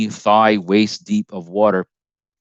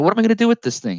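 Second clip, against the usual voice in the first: own past self; 13 semitones higher, much faster than usual. Retold or imitated. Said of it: "I'm like".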